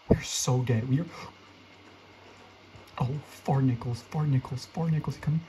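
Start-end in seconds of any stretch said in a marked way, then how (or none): none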